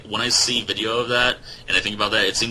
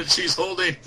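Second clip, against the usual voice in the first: excited; 11 semitones higher, slower than usual. Real, not acted. Cheering the connection back.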